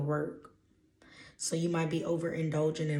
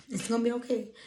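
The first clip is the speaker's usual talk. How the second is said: high-pitched, lilting comfort; full-voiced, raised-pitch reassurance; 6 semitones higher, faster than usual. Real, not acted.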